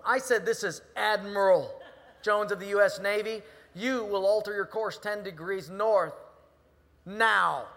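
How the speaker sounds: loud, insistent command; indignant officer shouting orders; admiral on megaphone. Acted. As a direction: repeat the order, bellowing every word. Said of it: "he gets back on the megaphone".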